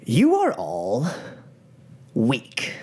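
slow, deliberate gang boss pronouncement; gang leader speaking. Acted. Gang leader opens his speech to the crowd, slow and commanding. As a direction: draw out each word for the room. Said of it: "he speaks".

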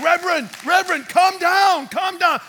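high, loud plea to calm down; alarmed pleading. Retold or imitated. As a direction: call out urgently over someone's shouting. Acts official pleading over the shouting.

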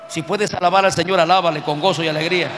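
loud exhortation to praise; rallying call. Real, not acted. Preacher urging the congregation to praise God, loud call.

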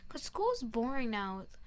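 ordinary talk

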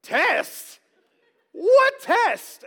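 loud, high-pitched disbelief; hammed-up panicked surprise; shocked student. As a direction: blurt it like a student caught totally off guard. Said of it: "I would immediately go".

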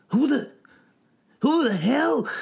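very high, bewildered outcry; high-pitched frightened yelp; startled panic. A narrator's reading. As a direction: stammer it out in shock. Old man bursts out of blankets.

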